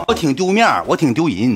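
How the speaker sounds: ordinary talk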